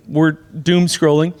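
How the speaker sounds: normal talking voice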